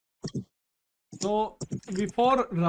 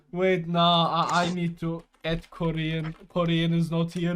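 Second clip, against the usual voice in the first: ordinary talk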